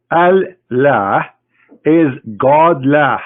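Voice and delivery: "Al-Lah" rising, then a slow "is God lah" leaning on "God"; split Al-Lah. Shown as a demonstration. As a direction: cut "Al-Lah" in two, then drawl "is God lah". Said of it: "The word A-L is a word mean God".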